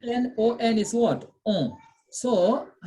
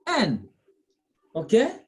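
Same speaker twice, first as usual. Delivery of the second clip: ordinary delivery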